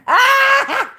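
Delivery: high shrieking yelp; delighted scream. Real, not acted. Host shrieking at a viewer's pickup line, delighted scream. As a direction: let out a thrilled shriek.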